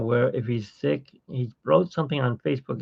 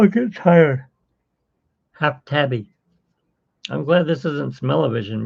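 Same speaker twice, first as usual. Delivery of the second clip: ordinary talk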